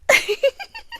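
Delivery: high airy giggle; a gleeful little titter; tickled laugh; delighted giggle. Acted. Delighted at your jumping.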